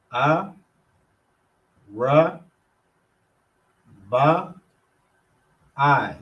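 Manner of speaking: A, Ra, Ba, I spelled out with pauses between; syllable by syllable. Shown as a demonstration. Teacher sounding out Arabai in separate syllables. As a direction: break Arabai into separate beats. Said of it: "Arabai, number four".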